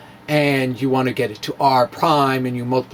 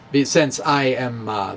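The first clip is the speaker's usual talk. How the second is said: usual speaking voice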